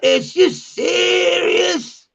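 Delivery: very high, long drawn-out SERIOUS; stretched, hammed-up street outrage; mock incredulous slang. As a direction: imitate slangy disbelief, slow and high. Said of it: "That's proper English".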